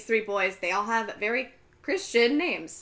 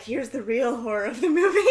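laughing aside; 4 semitones higher, 6 dB louder than usual. Retold or imitated. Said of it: "And I was like".

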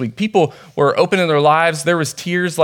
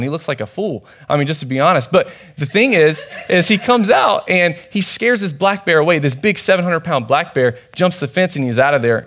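usual speaking voice

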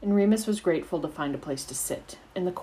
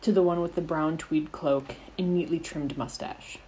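plain reading voice

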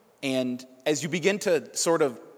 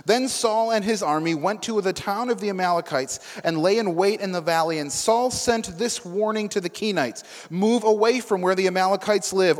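a steady, slightly raised Bible reading voice; scripture reading; 2 semitones higher, 4 dB louder than usual. Acted. Preacher reading scripture to the congregation, steady and raised. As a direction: read the passage clearly to the room.

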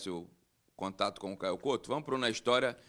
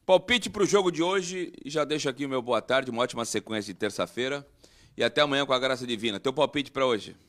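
announcer voice; 3 semitones higher, 8 dB louder than usual. Acted. Host announcer sign-off.

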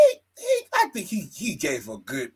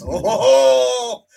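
mock gloating laugh; 7 semitones higher, 4 dB louder than usual. Mocking the wrestler as a gold-hoarding character, loud and gloating. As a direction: sneer like a leprechaun guarding gold. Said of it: "why you sound like George Lopez".

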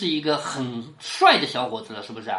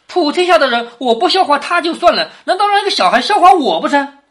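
proud boast; 7 semitones higher, 6 dB louder than usual. Acted. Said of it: "王熙凤笑着说".

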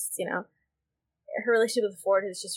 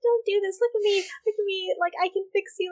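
higher, mocking pleading pick me voice; mock pick me girl; 11 semitones higher than usual. Mocking a pick me girl begging a troubled guy, higher mock plea. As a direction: whine it like a girl sure she can fix him. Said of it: "the pick me girls that are like".

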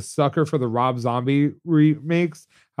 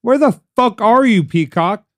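loud, higher exasperated rant, slow; mock rant; 6 semitones higher, 3 dB louder, much slower than usual. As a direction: vent at the app like it wronged you. Ranting at Peacock.

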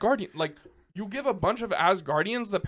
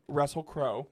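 usual speaking voice

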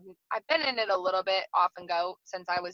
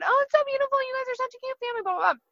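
much higher, fast, gushing commenter voice; mocking the commenters, way up high and rushed; 14 semitones higher, faster than usual. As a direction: parody the fans cooing over the photo. Mocking gushing social media comments.

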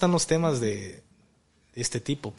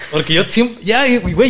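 ordinary talk

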